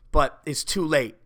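ordinary talk, nothing marked

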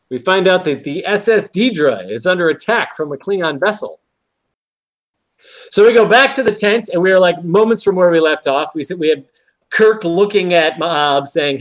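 ordinary delivery

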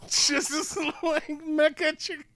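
high, slow words squeezed through laughter; real helpless laughter. Real, not acted. Cracking up over a mecha joke, laughing. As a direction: crack up while trying to speak.

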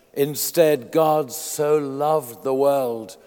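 slow, weighty, each word spaced; solemn emphatic verse; slow proclamation. Acted. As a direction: say it like the heart of the sermon.

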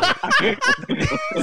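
very high burst of laughter; short high-pitched real cackle. Real, not acted. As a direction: let out a high, genuine laugh. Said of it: "That is actually his real laugh".

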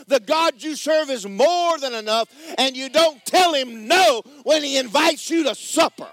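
high, loud preaching cadence building to a shout; full-force preaching. Acted. Preacher rousing the congregation about God's provision, full-force preaching. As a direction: preach it to the rafters.

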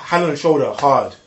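normal talking voice

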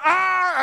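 high mock throwing yell; acted angry yell. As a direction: yell as if hurling a bottle. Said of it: "when you're angry".

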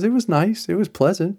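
a touch of polite lukewarm friend; mild high imitation. Woman shrugs off his word, mildly imitated lukewarm tone. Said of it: "She goes".

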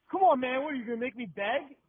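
high, loud mock plea; exasperated begging. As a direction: badger him like a pushy beggar. Acts out aggressive beggar pleading.

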